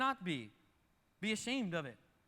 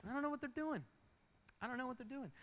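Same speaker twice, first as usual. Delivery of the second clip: higher-pitched mock bewilderment; mock onlookers; 6 semitones higher, 5 dB quieter than usual. Onlookers talking about someone's odd choices, mock bewilderment. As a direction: imitate baffled onlookers, pitched up. Mimicking gossiping onlookers judging.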